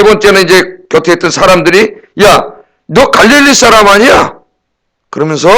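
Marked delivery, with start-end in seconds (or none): 2.2-4.4 캐묻는 말투. Retold or imitated. Bystanders confront Peter.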